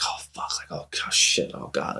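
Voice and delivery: breathless, voiceless hushed panic; panicked whisper. Retold or imitated. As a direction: whisper it in fear, under your breath. Panicking at seeing cops.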